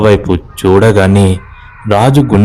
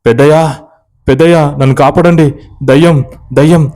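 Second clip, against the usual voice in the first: panicked shout for help; 6 semitones higher than usual. Acted. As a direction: scream for help while fleeing a ghost. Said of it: "అంటూ అరుస్తూ".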